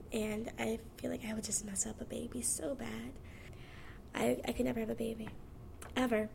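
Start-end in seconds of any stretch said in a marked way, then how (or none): 0.1-3.1 softer whispered admission. Real, not acted. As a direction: drop to a whisper as you admit it. Confessing she'd mess up a baby.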